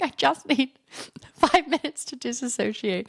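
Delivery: high, pleading self-imitation; whiny plea. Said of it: "I was like".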